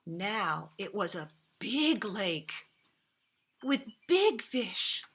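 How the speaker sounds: ordinary delivery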